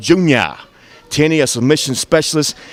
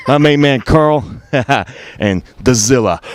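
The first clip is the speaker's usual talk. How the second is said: ordinary delivery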